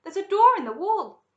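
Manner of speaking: high, loud exclamation; excited cry. A narrator's reading. Charlie spots a door and cries out to Grandpa, excited. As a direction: point out a discovery urgently. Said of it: "cried Charlie".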